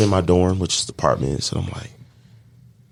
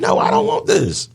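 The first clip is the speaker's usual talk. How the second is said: high-pitched mock whine; dismayed protest. Man recalls refusing what he's going through, high protest.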